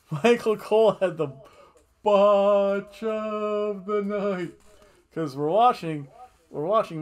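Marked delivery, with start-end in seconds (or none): 0.1-4.6 loud, high, drawn-out BOTCH; hyped-up mock award announcement; gleeful announcement. Real, not acted. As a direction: crow it like a big reveal.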